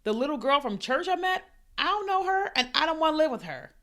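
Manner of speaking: high-pitched, mildly acted objection; retold reluctant protest. Protesting about living with a girl from church, mildly whiny reluctance.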